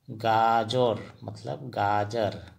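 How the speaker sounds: गाजर मतलब गाजर spoken slowly and deliberately; slow recap. Shown as a demonstration. Teacher recapping vegetable names, slow and deliberate. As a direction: spell it out slowly for learners. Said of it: "फिर से repeat कर रहा हूँ".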